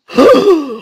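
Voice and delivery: high theatrical gasp; hammed-up intake of breath; shocked gasp. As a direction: gasp as if caught out in court. Acted shocked reaction to the note.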